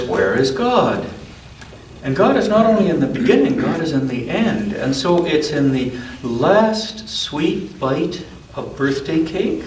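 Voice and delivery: gentle storybook read-aloud; unhurried picture-book narration; reading to a child. A narrator's reading.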